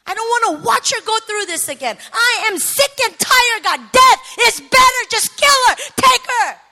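high, very loud fed-up outburst; desperate shouting. Retold or imitated. Daughter who can't watch mother suffer, screaming at God. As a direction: escalate into a full-force cry at God.

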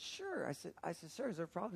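very soft retold reply; meek politeness. Driver answering a police officer, meek politeness. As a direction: answer small and careful, acting innocent.

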